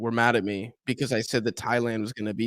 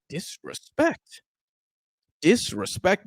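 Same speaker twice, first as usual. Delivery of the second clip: higher, slow disbelieving repeat; incredulous echo; 6 semitones higher, slower than usual. Retold or imitated. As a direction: echo the word as if it's absurd. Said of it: "I'm like".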